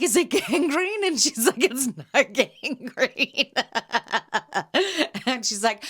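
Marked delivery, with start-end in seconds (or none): 0.0-1.0 high, slow question broken by a laugh. Retold or imitated. Joking fearful question to Barb. 1.6-3.6 slow, higher line with a laugh inside; laughing reassurance. Retold or imitated. Barb's reply retold.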